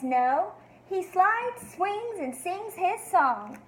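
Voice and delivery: lively storybook read-aloud for a class; expressive read-aloud for young listeners; reading to children. Acted. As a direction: read it to the kids like a picture book. Teacher reads picture book aloud.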